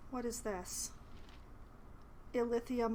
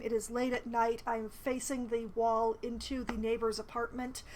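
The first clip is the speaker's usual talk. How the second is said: ordinary talk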